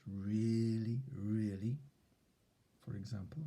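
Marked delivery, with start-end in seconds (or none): 0.1-1.8 "really" drawn out and leaned on; stretched really. Shown as a demonstration. Teacher showing which word to stretch, "really" stretched. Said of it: "the words that you stress".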